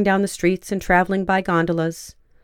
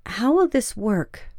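slow, higher pleading question; 4 semitones higher, slower than usual. A narrator's reading. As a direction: ask it as a plea, slowly. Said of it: "It was a plea".

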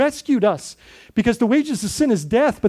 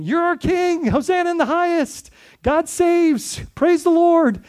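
higher, jubilant crowd shout of praise; cheering crowd; 8 semitones higher than usual. Retold or imitated. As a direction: hail the king like the crowd with palms. Crowd cheering at triumphal entry.

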